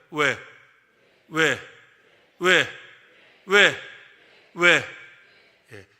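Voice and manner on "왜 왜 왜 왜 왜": shouted why, building; loud why. Shown as a demonstration. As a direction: call out the question loudly. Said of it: "한번 해볼까요?".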